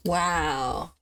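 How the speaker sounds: ordinary talk, nothing marked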